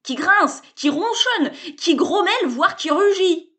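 loud, high, delighted salesman; excited pitch. A narrator's reading. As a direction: sell it with mounting gusto. Sébastien's pitch building.

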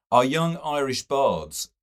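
lecturing critic sets up his rebuke; censuring. A narrator's reading. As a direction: open with a stern scold. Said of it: "John Eglinton censured".

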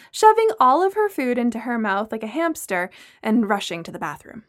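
usual speaking voice

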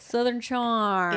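slow Southern accent; slow, put-on Southern drawl. Shown as a demonstration. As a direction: draw it out like a Southerner. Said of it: "It's more of a drawl".

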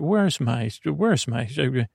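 ordinary delivery, nothing marked

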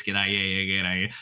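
loud, broad Aussie g'day; mock blokey Australian banter; broad Australian accent. Australian mates at a bar, broad Aussie accent. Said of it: "just be talking like this".

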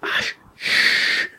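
whispered breathy shush; drunken shush. Retold or imitated. Drunk man shushes his wife after barging the door, whispering. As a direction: hush her urgently on breath.

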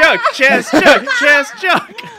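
high, loud pleading; insistent high cry. Acted. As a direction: plead for the joke to land. Insisting the joke be noticed.

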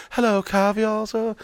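high, soft put-on waiter voice; mock-refined server, lifted and hushed; fancy waiter. Waiter offering caviar to club guests, fancy soft voice.

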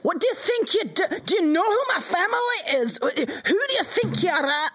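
haughty, high-pitched outrage; indignant intimidation. Acted. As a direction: demand it like offended nobility. Player intimidating the guards.

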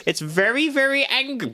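mock awed observer. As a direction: squeal it out in mock wonder. Continues Jono impression.

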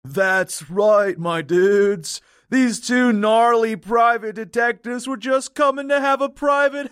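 dumb surfer. Acted. Ventriloquist speaks for the dead client to fool police, dumb surfer voice. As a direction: voice a dead man as a beach bum. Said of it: "making him sound like he's some dumb surfer".

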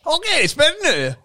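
high, drawn-out excited cry with a laugh. Real, not acted. Host as Ruben's call connects, giddy excitement. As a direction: stretch it out, pitched high and laughing.

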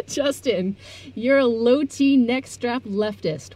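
slow, drawn-out teasing jab; mock sneer. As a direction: stretch it out like a taunt. Teasing insult to co-host.

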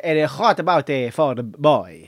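halting mock Spanish accent; put-on Spanish accent. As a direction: feel for each word in a faked Spanish accent. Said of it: "he pretended he was Spanish".

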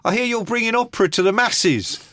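hammed-up punk heckle; Sid calling out. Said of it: "Sid comes in and he goes".

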